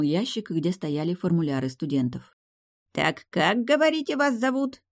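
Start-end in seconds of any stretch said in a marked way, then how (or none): 2.9-4.8 put-on high-pitched querying old man; old librarian. A narrator's reading. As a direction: ask the name in a raised old-timer's voice. Old librarian asks student's name.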